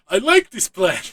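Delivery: loud, high delighted shout; gleeful Alexei. Retold or imitated. Alexei charging through the building, loud high glee. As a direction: bellow it with pure glee.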